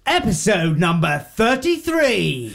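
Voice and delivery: high, slow announcer shout; excited announcement. Acted. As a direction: announce it like a game show host. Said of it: "Shall I do the episode number as well?".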